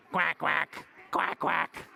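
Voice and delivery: punchy paired quacks; duck quack. Shown as a demonstration. Teacher answering what the duck says, duck quacks. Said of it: "What does the duck say?".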